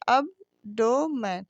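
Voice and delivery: abdomen slowly counted out as ab, do, men; split into syllables. Shown as a demonstration. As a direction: separate the three syllables of abdomen with small pauses. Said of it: "तीन हो गए". (abdomen को तीन टुकड़ों में, धीरे-धीरे बोलें)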